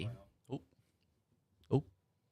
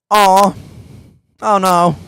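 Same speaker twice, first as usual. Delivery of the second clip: loud groaning dismay; a big disappointed oh no; 7 semitones higher, 16 dB louder, much slower than usual. Real, not acted. Reacting to forfeit total.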